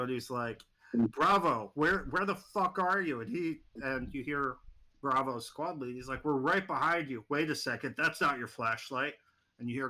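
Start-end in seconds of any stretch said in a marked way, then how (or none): 0.9-3.2 loud urgent shout into the radio. Acted. Squad leader shouting to Bravo over the radio, loud. 6.2-9.1 alarmed radio call. Acted. Bravo lead on radio, alarmed.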